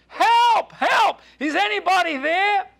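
loud, high-pitched desperate yell; yelling for help. Acted. John rejects the advice and seeks other help, yelling. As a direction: holler past the one who answered, for anyone else. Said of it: "Finally, John yelled".

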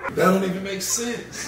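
high-pitched baffled complaint; real incredulous protest. Real, not acted. Repeating the complaint about the team, high incredulous voice. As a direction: insist on it, pitched right up.

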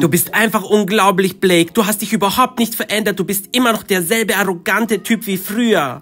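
usual speaking voice

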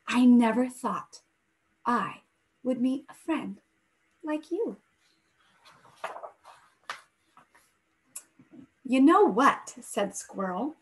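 gentle storybook read-aloud voice; story narration. A narrator's reading. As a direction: read it like a bedtime story for the class. Teacher reads picture book aloud.

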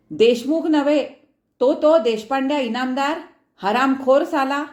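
loud, high furious outburst; thundering rage. A narrator's reading. Father thunders at his wife about the in-law. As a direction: roar the curse at the in-law. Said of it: "Haribhau कडाडले".

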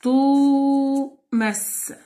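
"توماس" very slow, first vowel held long and high; chant-like hold on the "و" of "توماس"; exaggerated long vowels. Shown as a demonstration. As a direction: sound out "توماس" in two long, separated syllables. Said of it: "فيها مد بالواو ومد بالألف".

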